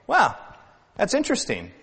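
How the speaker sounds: high, mock-impressed wow; detached appreciation. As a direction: play the impressed onlooker sitting back. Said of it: "something to sit back and say".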